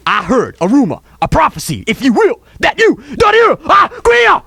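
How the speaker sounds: mock accusation. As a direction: build the charge to a loud, high finish. Mock prophecy building to accusation.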